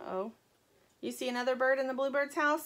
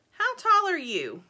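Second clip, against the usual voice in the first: chicken voice; 9 semitones higher, 7 dB louder, slower than usual. Acted. Dirt voiced, slow and high.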